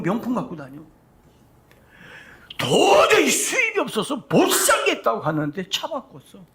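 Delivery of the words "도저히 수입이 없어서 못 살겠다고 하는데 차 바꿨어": higher, louder mocking exclamation, stress on 도저히; sarcastic pulpit shout. Real, not acted. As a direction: call out the hypocrisy loudly. Mocking complaints of congregants.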